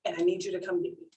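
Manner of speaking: hushed and pleading, speeding up; mom's quiet distress. Retold or imitated. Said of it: "And she's like".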